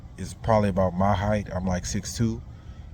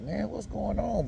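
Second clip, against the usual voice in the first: much higher, softer, baffled; confused surprise; 11 semitones higher, 7 dB quieter than usual. Retold or imitated. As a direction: wonder aloud, lost and confused. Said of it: "And I'm like".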